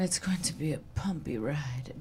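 dramatic hushed whisper. Acted. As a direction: whisper it like a movie line. Quoting a famous Betty Davis line.

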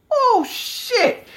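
very high cartoonish put-on voice. Spotting a wrestler on the street, high put-on joke voice. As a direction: squeak it out in mock astonishment. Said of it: "there goes the voice".

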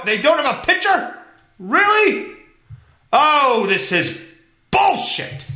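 high-pitched indignant disbelief; very angry. Real, not acted. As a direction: rise into an exasperated outburst. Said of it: "I'm very angry right now".

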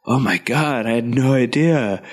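stunned surfer-dude whoa; lightly voiced Keanu, dazed. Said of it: "very Keanu about it all".

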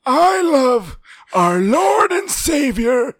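mock rally fan yelling praise for Trump. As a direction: preach it like a rally zealot. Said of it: "I want you to say".

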